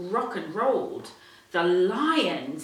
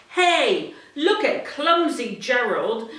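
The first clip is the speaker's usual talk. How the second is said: loud mocking jeer, a bit higher; sneering; 4 semitones higher, 6 dB louder than usual. Animals jeering at clumsy Gerald, sneering. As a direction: jeer at him with a laugh. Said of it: "the animals all sneered".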